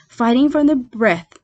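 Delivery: plain reading voice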